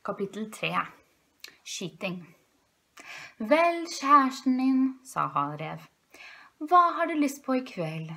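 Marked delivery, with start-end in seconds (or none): 3.4-4.8 high, loud, drawn-out fox voice; Herr Rev, kjærlig ektemann. A narrator's reading. Fox husband addresses his wife. 6.6-8.1 high, loud, courting fox voice; Herr Rev, kjærlig ektemann. A narrator's reading. Fox husband offers his wife dinner.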